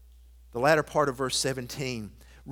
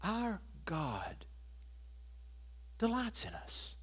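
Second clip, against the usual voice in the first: much softer, intimate sermon voice; tender pastoral hush; 7 semitones higher, 12 dB quieter, slower than usual. Acted. Pastor telling a child of God they are loved, softly. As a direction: lean in and speak it gently to each listener.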